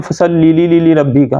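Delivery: "لی" rapidly repeated inside "فصلی لی لی لی ربی کا"; stuttered extra lams. Shown as a demonstration. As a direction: rattle the "لی" several times over. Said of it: "تین چار لام پڑھ جاتے ہیں".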